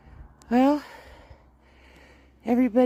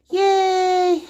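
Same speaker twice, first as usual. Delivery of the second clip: high loud happy yay; cheer; 12 semitones higher, 6 dB louder than usual. Real, not acted. Cheering the chickens, high and loud. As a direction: cheer them on.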